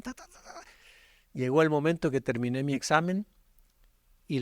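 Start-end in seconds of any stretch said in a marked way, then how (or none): 0.0-0.6 quick whispered ta ta ta for writing answers; rapid ta ta ta. Shown as a demonstration. Preacher mimicking himself racing through the exam, whispered ta ta ta. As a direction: tap out the answers in a whispered rush. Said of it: "me puse a contestar el examen".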